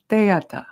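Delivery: "teata" as tay-ah-ta, no d; Tibetan pronunciation. Shown as a demonstration. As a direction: model "teata" with a tay opening. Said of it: "it's gonna sound like".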